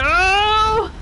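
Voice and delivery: very high, loud gasping "oh"; startled cry. Real, not acted. Streamer nearly dying in game, high startled cry. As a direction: burst out in shock.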